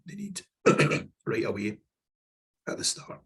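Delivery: hoarse, fading voice; losing voice. Real, not acted. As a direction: voice giving out, straining to finish. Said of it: "I'm starting to lose my voice".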